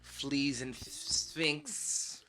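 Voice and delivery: slow, drawn-out announcer voice; mock host intro. Returning from the break, a slow mock host greeting. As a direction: ham up the show intro, slow.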